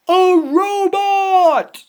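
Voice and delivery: drawn-out delighted cry; excited exclamation. Real, not acted. Reader sees the robot page, slow excited cry. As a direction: say it amazed and slow.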